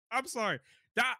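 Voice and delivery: ordinary delivery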